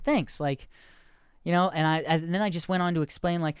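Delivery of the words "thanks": chirpy thanks. Retold or imitated. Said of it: "And I was like".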